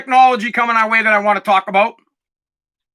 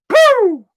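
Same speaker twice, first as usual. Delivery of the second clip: high, loud "pooh" of something launching; explosion noise; 12 semitones higher, 4 dB louder than usual. Shown as a demonstration. Host showing his head popping off, explosion sound. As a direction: make the sound of a head blasting off.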